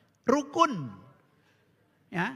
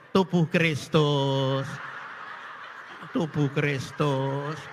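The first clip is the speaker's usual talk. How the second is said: put-on shaky old minister; 8 semitones lower, slower than usual. Trembling prodiakon giving communion.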